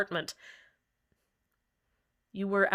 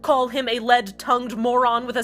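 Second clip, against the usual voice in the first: higher and louder, heated sarcastic tirade; bitter rant; 7 semitones higher, 6 dB louder than usual. Acted. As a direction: spit out every insult in a rising fury. Host rants bitterly about fight.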